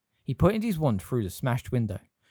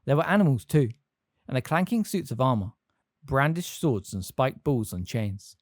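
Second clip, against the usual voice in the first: ordinary delivery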